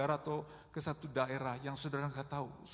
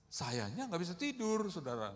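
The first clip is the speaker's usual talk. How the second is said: normal talking voice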